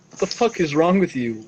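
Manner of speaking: ordinary talk, nothing marked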